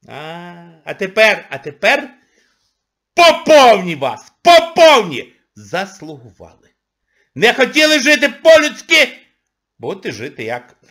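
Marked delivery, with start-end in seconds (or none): 0.1-9.1 loud gloating. Real, not acted. Gloating at the audience.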